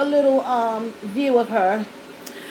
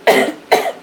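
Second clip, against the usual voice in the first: breathy throat-clearing cough; lingering cough after choking; small cough; 4 dB louder than usual. Real, not acted.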